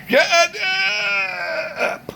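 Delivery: drawn-out, high-pitched shout; full-force, stretched-out screech for silence. Acted. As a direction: yell it long and high to silence a room. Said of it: "You go louder than they are".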